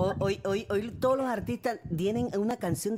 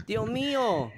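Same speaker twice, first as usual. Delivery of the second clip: ordinary delivery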